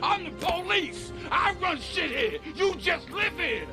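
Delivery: high, ranting outburst; defiant boast. Acted. Cop telling everyone he runs things, defiant rant. Shouting down the neighbourhood.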